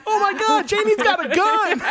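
high-pitched mock alarm; mock panicked cry. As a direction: squeal it in hammed-up panic. Acts out movie character's panicked cry.

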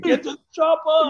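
high, strained action-hero yell; thick Austrian-accented shout; Schwarzenegger. Retold or imitated. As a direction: holler it with hammed-up urgency. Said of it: "just like Schwarzenegger".

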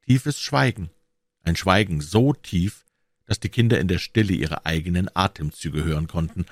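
plain reading voice

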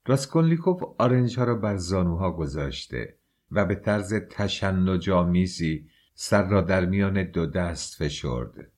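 usual speaking voice

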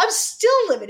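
still livid. Real, not acted. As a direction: let the fury still in you push the voice up. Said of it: "as you can hear in my voice".

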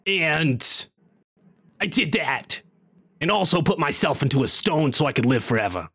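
imperfect comedian impression, explaining himself; Gilbert Gottfried. Wizard explaining why he became a stone, imitating Gottfried. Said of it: "sounds like Gilbert Godfrey".

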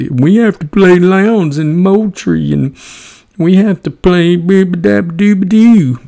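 high, far-off mocking whine of an excuse-maker; mocking whiner. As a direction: sneer it in a silly high whiny voice.

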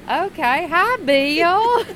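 chirpy Sheila. Retold or imitated. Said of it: "And so she goes".

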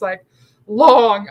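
normal talking voice

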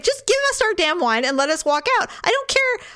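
high-pitched impatient protest; mock exasperation. Customer fed up with the wine guy, mock exasperated. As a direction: complain with rising pitch, played up.

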